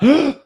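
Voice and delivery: quick gasping sip of air on top, then squeeze; sharp inhale. Shown as a demonstration. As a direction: show the extra gulp of air at the top of the breath. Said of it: "A little more at the top".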